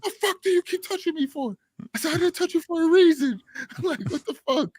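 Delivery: high-pitched mock outrage at the guy; high retold yell. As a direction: pitch way up, indignant and incredulous. Retold yelling at the stranger.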